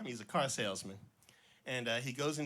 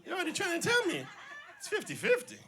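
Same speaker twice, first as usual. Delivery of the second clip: superstitious cousin; 5 dB louder than usual. Retold or imitated. Superstitious cousin quoted, laughing.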